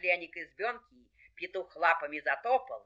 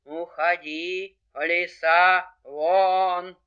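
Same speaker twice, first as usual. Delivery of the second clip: slow howled order, swelling louder; howling wolf; 6 dB louder, much slower than usual. Acted. Wolf orders the fox out, howling. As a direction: draw each word out like a howl. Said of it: "Волк как завоет".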